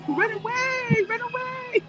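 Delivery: mock high run away, half laughing; playful high repeat. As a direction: chirp it up high like a joke. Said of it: "I think you said in French".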